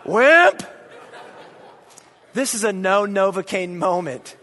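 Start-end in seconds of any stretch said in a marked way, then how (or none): 0.0-0.6 high, loud laughing jeer; mocking yell. Dad jeering at his son over no Novocaine, laughing shout.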